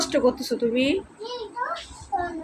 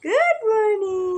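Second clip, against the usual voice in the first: singsong good morning; 9 semitones higher, much slower than usual. Real, not acted. Greeting baby in the morning.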